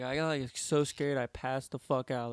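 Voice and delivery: usual speaking voice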